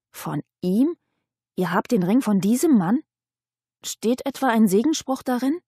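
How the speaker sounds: incredulous questions, pitch swinging upward; astonished exclamation. A narrator's reading. Mechthild questions Johanna about the ring, astonished. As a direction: call out in astonishment. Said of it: "die erstaunt die Augen aufriss und rief".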